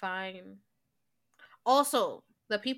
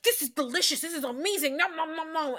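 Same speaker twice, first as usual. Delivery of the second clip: higher-pitched gobbling imitation; mimicked greedy raving; 7 semitones higher than usual. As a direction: rave between cartoonish munching noises. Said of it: "Cookie Monster".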